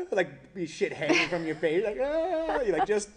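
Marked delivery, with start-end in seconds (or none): 2.0-2.6 high, loud mock-crying wail; imitated hysterical wail. Storyteller showing his breakdown, mock hysterical wail. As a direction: wail "ah" in a hammed-up crying fit. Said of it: "this type of crying is like".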